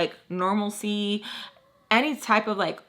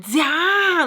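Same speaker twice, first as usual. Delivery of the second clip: high, loud, drawn-out exasperation; so annoyed; 8 semitones higher, 5 dB louder than usual. Retold or imitated. Husband telling his wife it was enough, so annoyed. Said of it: "he is so annoyed".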